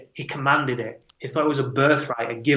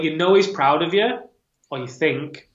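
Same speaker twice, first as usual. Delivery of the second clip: normal talking voice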